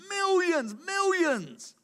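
millions, millions. sung out high with delight; excited exclamation. Real, not acted. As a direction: marvel at the huge number. Preacher rapturously repeats numbers.

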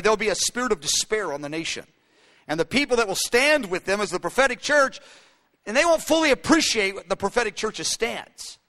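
normal talking voice

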